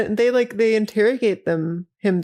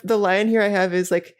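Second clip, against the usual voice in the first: ordinary talk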